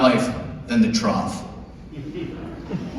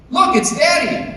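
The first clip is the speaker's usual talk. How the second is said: put-on high excited little-girl yell; child's yell; 13 semitones higher than usual. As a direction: call out like a delighted toddler. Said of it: "she yells out".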